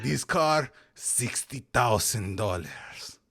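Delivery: put-on loud, slow Spanish-Japanese accent; a hint of a Spanish-Japanese accent. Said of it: "interesting Spanish-Japanese accent you had".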